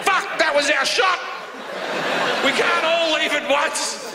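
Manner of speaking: high, pleading dad voice; panicked dads. Retold or imitated. Dads missing their chance to escape, high and pleading. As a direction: whine it like a trapped dad. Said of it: "other dads that are like".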